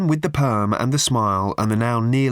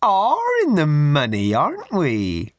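very high, slow, sugary raffle-lady voice; a prim, simpering old charity lady pushing tickets; high twittering church-fete lady; raffle woman; 9 semitones higher, much slower than usual. Acted. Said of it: "said the woman".

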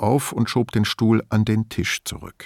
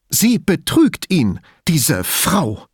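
slow, high, bitter accusation; spat out venomously; 7 semitones higher, 4 dB louder, slower than usual. A narrator's reading. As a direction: spit out the last word like a dragon. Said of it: "spie sie wie ein Drache aus".

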